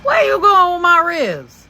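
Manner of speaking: put-on high, loud call of Baby!, like a big-hearted neighbour catching a kid; kind project lady. Lady catching a hungry teen at her grill, high loud call. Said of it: "She said".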